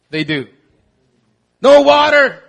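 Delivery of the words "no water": loud mock complaint, pitched up; whiny Israelites. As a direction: wail it like a spoiled crowd.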